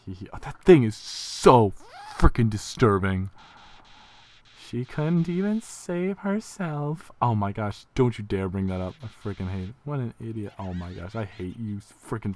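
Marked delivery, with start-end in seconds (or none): 4.7-7.1 mocking villain. Reading villain's mocking game line.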